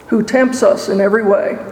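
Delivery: ordinary talk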